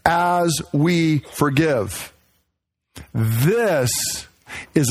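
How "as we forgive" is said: "as we forgive" very slow, "as" weighted; slow stress on as. Shown as a demonstration. Pointing the congregation to the phrase, slow stress on "as". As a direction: linger on "as", then "we forgive". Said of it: "that little phrase".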